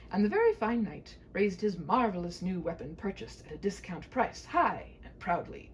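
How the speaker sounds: plain reading voice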